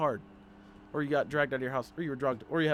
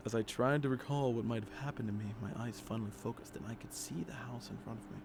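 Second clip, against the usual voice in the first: lower, measured reading voice; story narration; 3 semitones lower, 6 dB quieter than usual. Acted. Player reading horror game text, in subdued narration. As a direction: read the horror story aloud evenly. Reads the game's story aloud.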